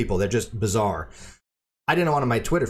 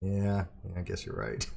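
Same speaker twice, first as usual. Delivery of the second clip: low, much softer mock surrender; sheepish concession; 3 semitones lower, 10 dB quieter than usual. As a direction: give in quietly, dropping way down in pitch. Retold giving in to co-host.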